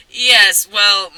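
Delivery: ordinary talk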